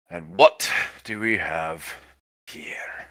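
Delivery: menacing, drawn-out, then hushed; swaggering soldier voice. Acted. GM voices the armed man.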